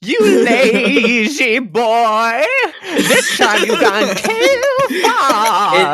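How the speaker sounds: high, wailing, drawn-out spooky ghost. Retold or imitated. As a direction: stretch it slowly like a hammy ghost. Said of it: "spooky ghost voice".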